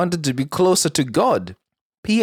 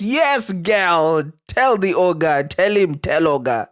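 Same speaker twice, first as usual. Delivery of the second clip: cheering; 4 semitones higher, slower than usual. Real, not acted. Cheering the woman's reply.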